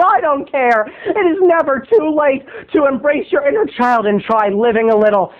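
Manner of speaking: usual speaking voice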